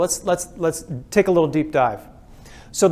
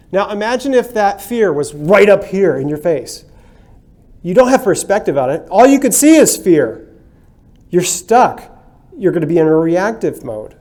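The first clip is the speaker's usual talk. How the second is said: intense emphasis; 2 semitones higher, 7 dB louder than usual. Shown as a demonstration. Showing fear right in your face.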